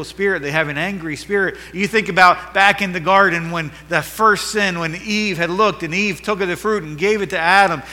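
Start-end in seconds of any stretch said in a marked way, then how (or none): 1.7-7.8 louder, higher, driving pulpit voice; fired-up sermon delivery; preaching at full force. Acted. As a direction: build it up like a revival preacher.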